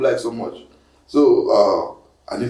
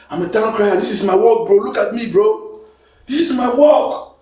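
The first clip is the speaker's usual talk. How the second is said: high, loud comic lament; mock wailing protest; 9 semitones higher, 4 dB louder than usual. Town crier defending his job to a critic, mock wailing. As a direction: plead in a raised, hammed-up wail.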